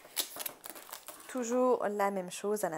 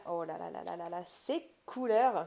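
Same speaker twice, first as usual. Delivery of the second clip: delighted wonder; 2 semitones lower, 3 dB louder than usual. Real, not acted. Marvels at the colours.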